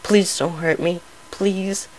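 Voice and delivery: frightened pony begging, lightly voiced; scared pony pleading. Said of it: "what appeared to be crying".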